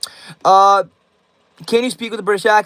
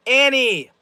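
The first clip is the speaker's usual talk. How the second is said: ordinary talk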